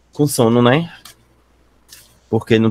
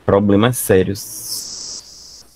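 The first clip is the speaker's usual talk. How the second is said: slow and drawn out; much slower than usual. Real, not acted. Teacher dramatizes serious problems.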